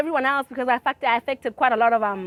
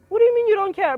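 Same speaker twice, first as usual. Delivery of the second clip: indignant retort; 11 semitones higher, 6 dB louder, faster than usual. Retold or imitated. Retold angry reply to Tiamo.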